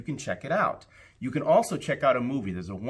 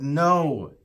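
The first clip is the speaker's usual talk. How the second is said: firm no; 4 semitones higher, 5 dB louder than usual. Acted. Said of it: "And Max said".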